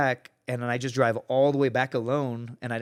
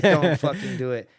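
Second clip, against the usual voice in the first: louder, emphatic mock order; retold stern warning; 2 semitones higher, 6 dB louder than usual. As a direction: act out a friend forbidding it. Buddy repeats his warning.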